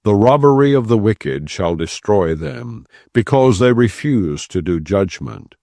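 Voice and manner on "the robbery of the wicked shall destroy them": ordinary talk, nothing marked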